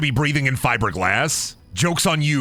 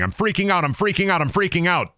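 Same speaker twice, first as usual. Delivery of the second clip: mock panicked yelling; 7 semitones higher than usual. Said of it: "flying through the air yelling".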